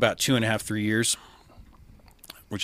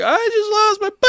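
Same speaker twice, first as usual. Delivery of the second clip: high-pitched, half-sobbed cry of grief; a loud, strained imitation of crying on the phone; retold bawling; 9 dB louder than usual. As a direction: voice cracking up high as he breaks down. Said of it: "I just start bawling on the phone".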